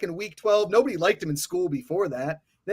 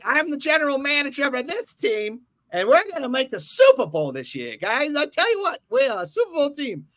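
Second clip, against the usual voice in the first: Jerry Jones impression; 8 semitones higher than usual. Mocking the Cowboys owner in a high old Texan voice. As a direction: puff up like a boastful old Texas GM. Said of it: "what was that Jerry Jones accent supposed to be".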